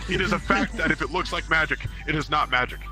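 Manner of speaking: ordinary talk, nothing marked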